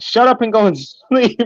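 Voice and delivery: high mock scolding mom; imitating mom. Mom scolding her beatboxing teen at night, high mock scolding. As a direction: pitch it up, annoyed but half laughing. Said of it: "my mom was like".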